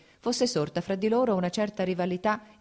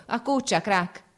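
sharp raised call to a scratching dog; shout at the dog; 6 semitones higher, 5 dB louder, slower than usual. A narrator's reading. Stepan Arkadych scolding his dog in the hay, loud shout. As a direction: bark the command at the hound. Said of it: "gridò al cane che si grattava".

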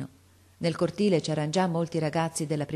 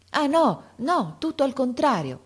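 higher and louder, bursting out; heartfelt exclamation; 9 semitones higher, 4 dB louder than usual. A narrator's reading. Boy eagerly reassures the worried father, heartfelt outburst. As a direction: blurt it with all your heart. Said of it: "esclamò Derossi con uno slancio dell'anima".

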